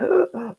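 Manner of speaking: a short, caught-breath gasp; imitated gasp. Imitating an upset person's short gasp. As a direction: gasp as if about to cry. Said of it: "people who are like".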